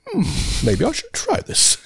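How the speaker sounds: mock pondering. Pondering voice at buffet.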